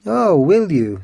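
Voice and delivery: high, loud, swooping retort; acted flare of defiant anger; sudden fury. A narrator's reading. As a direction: fire back indignantly. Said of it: "in a sudden fury".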